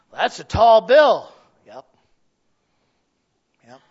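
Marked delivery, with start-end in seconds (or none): 0.1-1.3 loud, slow skeptical objection; mock doubter, raised and drawn out; doubting listener. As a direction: scoff at the challenge.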